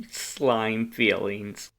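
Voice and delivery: slow teasing drawl. Acted. Teasing aside to brother.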